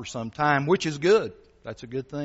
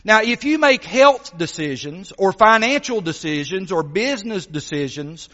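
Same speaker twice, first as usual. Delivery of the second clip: lively, louder preaching voice, storytelling to the pews; sermon delivery; 7 semitones higher, 9 dB louder than usual. Acted. Preacher telling the congregation a story, lively preaching. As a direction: preach it with energy, painting the scene.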